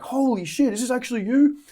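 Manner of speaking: high, thrilled disbelief; young fan amazed the creator wrote back; excited fan. Retold or imitated.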